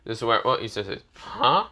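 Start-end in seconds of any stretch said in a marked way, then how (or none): 1.2-1.7 a sudden high yelp of disbelief; surprised huh. Acted. Stunned at the girl's reveal, high surprised huh. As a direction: jolt up in pitch, caught off guard. Issei hears Kiba is a girl.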